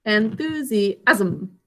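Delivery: "Enthusiasm" slowly, syllable by syllable; en-thu-si-asm, keeping the end of "Enthusiasm" together; slow, split-up "Enthusiasm"; sounded out. Shown as a demonstration. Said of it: "That last syllable is all together".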